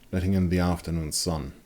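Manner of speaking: usual speaking voice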